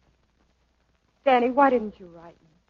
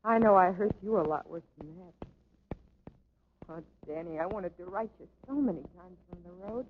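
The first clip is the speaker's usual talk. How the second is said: tender hush; 4 semitones lower, 9 dB quieter than usual. Acted. Tender reconciliation, hushed.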